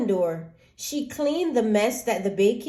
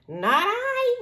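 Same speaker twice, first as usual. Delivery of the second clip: high, honking goose refusal; 13 semitones higher than usual. Acted. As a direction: toss it off like a silly goose.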